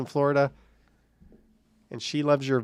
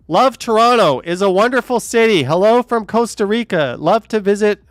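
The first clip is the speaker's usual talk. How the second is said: shouted, higher and louder; reading in all caps; 8 semitones higher, 7 dB louder than usual. Shown as a demonstration. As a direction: yell every word like caps lock. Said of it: "lay off the caps".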